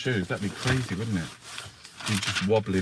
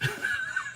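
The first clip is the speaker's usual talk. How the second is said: burst of laughter; 7 dB quieter than usual. Real, not acted. Laughing at own joke.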